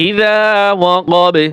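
ইযা ওয়াকাবে with drawn "zaa", echoing b; qalqalah stop. Shown as a demonstration. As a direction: stretch "za", bounce the b ending ওয়াকাবে. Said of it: "কলকলা হবে". (ইযা ওয়াকাবে, শেষে বা-তে কলকলা)

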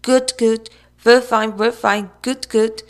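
high, loud repeated cries; loud, manic chant; crying out. A narrator's reading. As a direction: call it out like an excited big bird.

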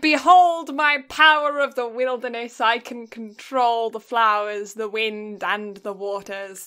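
loud, grand overlord boast; dark lord. Pretend. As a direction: declare your power like a fantasy villain. Fantasy lord boast bit.

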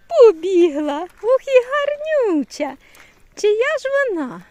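loud high slow admiring exclamation; excited. Real, not acted. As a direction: gush over the pretty cat. Excited about a cat.

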